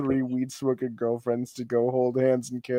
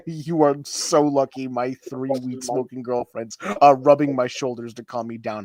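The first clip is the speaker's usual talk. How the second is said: ordinary delivery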